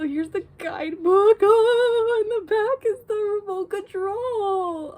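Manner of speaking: high, loud, near-tearful gushing; real thrill, high and loud; overjoyed squeal. Real, not acted. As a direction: squeal over each card. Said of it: "my heart is racing".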